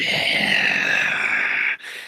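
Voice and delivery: long unvoiced creature breath; creature exhale. Jaeger makes a noise in narration.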